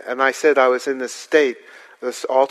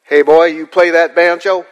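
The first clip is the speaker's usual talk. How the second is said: rural local; 5 semitones higher, 9 dB louder than usual. Retold or imitated. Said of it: "someone would pull up alongside me and say".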